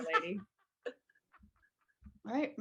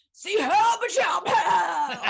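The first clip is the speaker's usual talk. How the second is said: loud, drawn-out calls for help; mock cry for help; 8 semitones higher, 6 dB louder, much slower than usual. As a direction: yell help like you're being sick. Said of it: "from the bathroom we heard".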